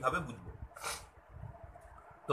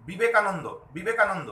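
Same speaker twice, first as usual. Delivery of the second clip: "Vivekananda" rattled off quickly and loudly, twice; quick, run-together "Vivekananda"; hurried; 4 dB louder than usual. Shown as a demonstration. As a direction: rush through "Vivekananda" as one unbroken word. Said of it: "তাড়াতাড়ি করে বললে আমরা বুঝতে পারবো না".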